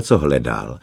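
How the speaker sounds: usual speaking voice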